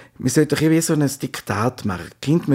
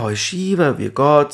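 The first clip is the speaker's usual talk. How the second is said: drawn-out mock greeting; slower than usual. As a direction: stretch the greeting out sweetly, mocking it. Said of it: "Da gibt's sich jemand".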